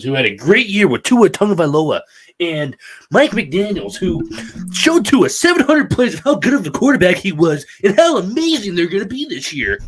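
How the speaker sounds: high, loud, mock-gushing praise; salty sarcasm. Real, not acted. Salty fan mocking a rival team's great year, sarcastic gushing. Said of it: "Mike is a little salty".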